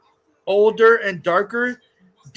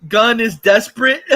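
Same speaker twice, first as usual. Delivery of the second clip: laughing taunt; 2 semitones higher, 6 dB louder than usual. Real, not acted. Laughing rant at Matt Reeves.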